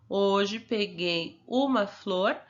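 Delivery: "Hoje peguei uma flor" read very slowly, word by word, rising on "uma"; slow dictation. Shown as a demonstration. Teacher dictating "Hoje peguei uma flor" slowly for the pupil to copy. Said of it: "você vai escrever a mesma frase".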